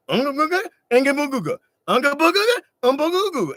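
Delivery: high, bouncy caveman gibberish; mock caveman grunt. Desiree offers a trade in caveman talk, high hammed-up grunts. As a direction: grunt it like a cartoon cavewoman. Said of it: "And she's like".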